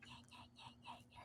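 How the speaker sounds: whispered mock nyah-nyah; very soft, sing-out taunt under your breath; mocking imitation. Prankster imitating the snippy prank target, whispered mockery. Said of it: "Sinabi niya".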